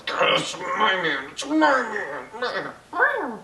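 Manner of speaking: high, slow, drawn-out mock possessiveness; Liza. Reviewer imitates Liza squeezing Sansa's hands, creepy and high-pitched. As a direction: stretch it out in a strained high put-on voice. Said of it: "squeezing her hands like".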